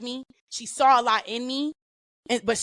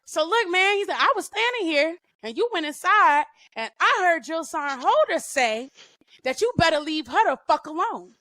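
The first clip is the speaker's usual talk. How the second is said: embellishing drill sergeant; 7 semitones higher than usual. Retold or imitated. Drill sergeant embellishing what the private said to another sergeant. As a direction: tell on her with relish. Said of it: "he embellishes".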